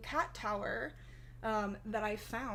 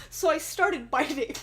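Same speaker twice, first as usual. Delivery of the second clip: usual speaking voice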